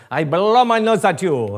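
jokey French knight impression from a sermon; mock French knight taunt. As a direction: play the Holy Grail French knight jeering, a bit over the top. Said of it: "the Holy Grail and the French knight".

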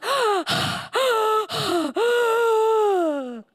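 long high gasp for air; loud gasp. Acted. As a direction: gasp like a fish out of water.

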